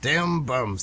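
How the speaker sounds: "Dem bums" with a Brooklyn twang, d for th. Retold or imitated. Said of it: "they called them".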